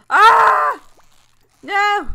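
very loud, high yell of dismay; alarmed scream. Real, not acted. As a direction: scream as the catch slips away. Failing at fishing minigame.

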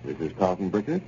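smooth, measured commercial pitch; old-time radio ad read and goodnight sign-off; sponsor ad read. Acted.

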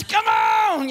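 high, stretched-out coach's yell; sideline coach. Acted. Coach urging players from the sidelines, yelling. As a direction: holler it across the field. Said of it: "I'm the coach on the sidelines".

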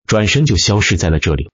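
ordinary delivery, nothing marked

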